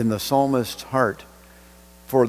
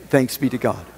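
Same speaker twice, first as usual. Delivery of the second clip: ordinary delivery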